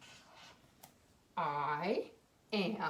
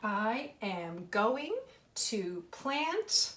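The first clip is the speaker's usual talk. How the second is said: very slow, word by word, rising on plant; slow dictation; much slower than usual. Shown as a demonstration. Teacher reads her sentence slowly to the children. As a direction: read it out slowly for the class. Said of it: "teacher slowly reading her sentence".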